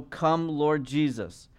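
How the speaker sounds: drawn out slowly, like a prayer; slow, reverent plea; slow prayerful plea. Acted. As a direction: let each word linger, pleading. Scripture quote read as prayer.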